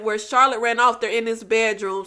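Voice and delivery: usual speaking voice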